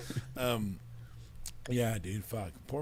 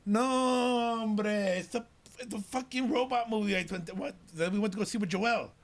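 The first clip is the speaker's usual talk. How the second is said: loud, high mock insistence; retold exasperated dad; 10 semitones higher, 5 dB louder than usual. Dad setting everyone straight about the robot movie, hammed-up exasperation. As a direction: stretch the opening, then argue it out.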